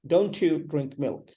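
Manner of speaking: ordinary delivery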